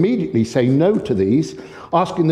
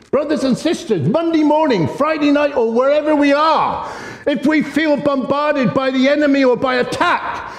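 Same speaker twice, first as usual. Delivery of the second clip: fervent preaching; 12 semitones higher than usual. Real, not acted. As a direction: lift the whole church with it. Preaching at full force.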